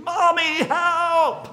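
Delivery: put-on full-blast, high-pitched child's scream for mom; screamed plea. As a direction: scream for help at the top of your voice, drawn out. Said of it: "and I screamed".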